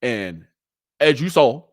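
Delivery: ordinary talk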